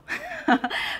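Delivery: short high-pitched laugh. Real, not acted. Speaker amused by own penniless example, laughing. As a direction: burst into a brief high laugh.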